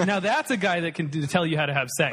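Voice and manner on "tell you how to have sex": normal talking voice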